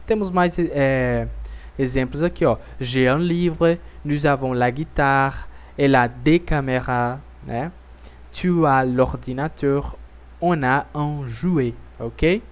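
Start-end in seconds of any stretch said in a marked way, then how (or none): none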